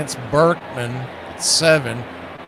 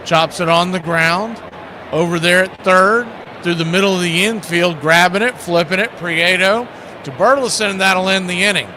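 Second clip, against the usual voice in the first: louder, higher ground-ball call; excited play-by-play; 4 semitones higher, 3 dB louder than usual. Acted. Calls a groundout.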